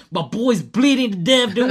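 high, loud retold panic; frantic dad, half laughing; panicked. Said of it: "I was like".

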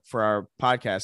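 ordinary talk

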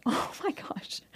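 breathless whisper to herself; whispered aside. Retold or imitated. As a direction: whisper it in shock. Inner thought, whispered.

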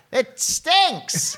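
high-pitched cartoon critic; Jay Sherman catchphrase. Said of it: "Maybe Jay Sherman's there?".

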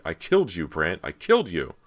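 loud, emphatic protest; angry cry. A narrator's reading. Callie furious that Brant still stands, crying out. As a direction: hammer it out in outraged disbelief. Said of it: "she cried".

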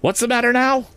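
very high-pitched, exasperated questioning; very sharp demand. A narrator's reading. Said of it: "more sharply than before".

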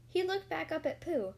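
plain reading voice

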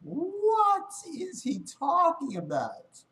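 high, slow, stretched-out what is he talking about; real puzzled outcry at the video; baffled disbelief. Real, not acted. Baffled by the clip's line.

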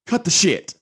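loud no-nonsense order; firm command. Real, not acted. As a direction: tell them off firmly. Message to royal family.

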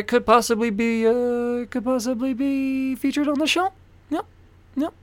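high, strained mock Scottish accent; hammed-up Scottish accent, high and choked; choking Scotsman. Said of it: "It's like a choking Scotsman".

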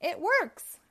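high, loud triumphant cry; excited cry. Acted. Robotnik seeing his robot come alive, loud excited cry. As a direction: shout it like the invention finally worked. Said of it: "cried Robotnik".

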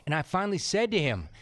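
ordinary delivery

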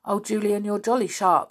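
full of admiration for Julian; a spirited girl impressed and worried; George. Acted.

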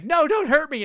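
high, loud pleading squeal; mock boss scream. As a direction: squeal like a cowardly monster. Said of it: "he's screaming at me".

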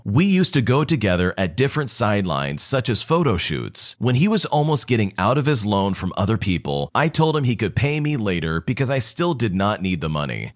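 usual speaking voice

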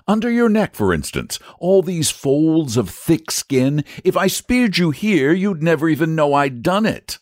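high-pitched, coaxing knight; reasonable knight. Acted. Saint George picks a painless spot to spear, coaxingly.

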